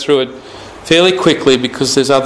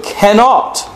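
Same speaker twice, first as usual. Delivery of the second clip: cannot said high and drawn out; stress on cannot; 6 semitones higher than usual. Shown as a demonstration. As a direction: hit the word cannot hard. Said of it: "let me emphasise that word".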